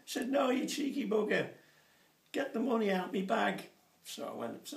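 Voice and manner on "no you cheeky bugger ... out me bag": higher, louder mock telling-off; wife scolding husband from the shower, mock high. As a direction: play the wife snapping back from upstairs.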